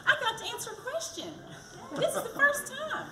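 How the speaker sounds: high, fast, laughing delight; excited laughing. Real, not acted. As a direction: laugh it out, thrilled. Delighted at first question.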